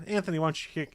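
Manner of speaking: ordinary talk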